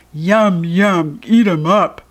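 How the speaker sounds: a hint of a hungry monster chanting; evil toilet voice. A narrator's reading. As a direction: gobble the words like a greedy toilet monster. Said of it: "cried the evil toilet".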